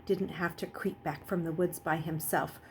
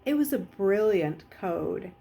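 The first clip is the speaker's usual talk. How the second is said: plain reading voice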